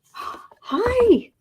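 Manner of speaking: high squealed greeting after a gasp; gushing hi to cat. Real, not acted. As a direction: squeal hello like you just spotted your pet. Greets her cat on stream.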